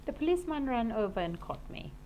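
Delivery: ordinary delivery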